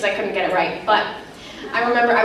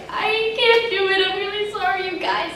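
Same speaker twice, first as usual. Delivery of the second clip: imitating sobbing; 9 semitones higher than usual. Eleven-year-old breaking down mid-take, tearful. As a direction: mimic a crying child giving up. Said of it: "I just started bawling, like, sobbing".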